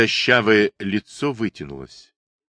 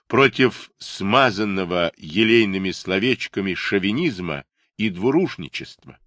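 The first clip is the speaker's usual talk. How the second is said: ordinary delivery, nothing marked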